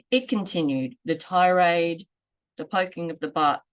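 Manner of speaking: usual speaking voice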